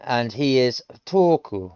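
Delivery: ordinary talk, nothing marked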